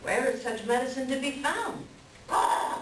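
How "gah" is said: a harsh breathy raven croak; raspy bird croak. Acted. Raven croaks while agreeing with the elder. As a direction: croak like a raven clearing its throat.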